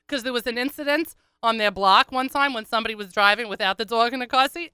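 normal talking voice

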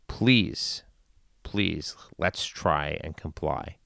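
slow, soft, sorrowful plea; slow and sad. Real, not acted. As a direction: plead gently and sadly, slowly. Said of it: "I'm speaking slowly and methodically and sadly".

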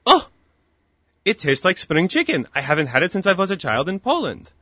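lightly voiced old Polish grandmother; light imitation of a Polish-born grandmother's accent; grandmother's Polish Yiddish accent. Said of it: "You do that accent really well".

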